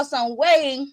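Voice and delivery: ordinary talk, nothing marked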